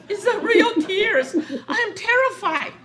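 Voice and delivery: high, loud, laughing insistence; laughing protest. Retold or imitated. Tina insisting to Auntie Jen her tears were real, laughing. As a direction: protest through giggles.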